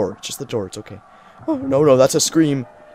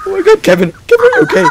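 panicked shout; 9 semitones higher, 9 dB louder, faster than usual. Real, not acted. Panicked calling to friend.

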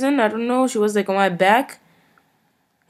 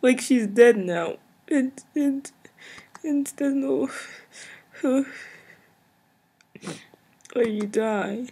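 soft, halting, choked-up voice; fighting back tears over her death; near tears; 3 semitones higher, 7 dB quieter, slower than usual. Real, not acted. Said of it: "I'm going to cry now".

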